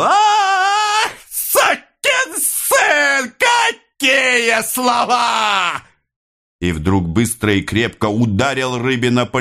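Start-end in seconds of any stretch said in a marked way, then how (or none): 0.0-5.8 very high, drawn-out amazed wail; amazed, whistling sing-song. A narrator's reading. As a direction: sing it out slowly in piercing astonishment. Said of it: "свистящим голосом изумлённо запел". (изумлённо, нараспев, свистящим голосом)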